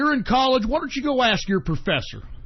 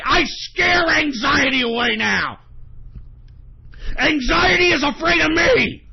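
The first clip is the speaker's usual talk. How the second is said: boastful shout; 6 semitones higher, 4 dB louder than usual. Real, not acted. Host boasting to his audience he scares anxiety, loud defiant shout. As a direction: bellow it like you've beaten anxiety.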